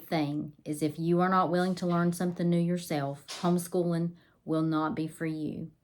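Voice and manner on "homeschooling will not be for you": ordinary talk, nothing marked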